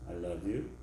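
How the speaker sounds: low, soft I love you. Shown as a demonstration. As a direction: murmur it. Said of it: "what if I say, this".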